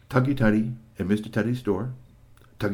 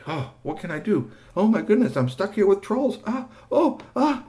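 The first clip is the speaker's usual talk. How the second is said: panicked; 10 semitones higher than usual. Acted. GP stuck up a tree with trolls, panicked and high. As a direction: fret in rising alarm.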